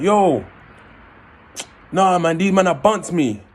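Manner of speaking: upset friend on phone. Retold or imitated. Friend calls after being robbed.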